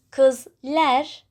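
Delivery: kızlar ending in a front "-ler"; kızlar mispronounced as "kız-lær"; mismatched suffix vowel. Shown as a demonstration. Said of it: "better to say".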